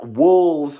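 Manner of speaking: loud wolves, flung out; loud exasperation. Real, not acted. Judging the family, a loud exasperated wolves. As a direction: declare it like a verdict.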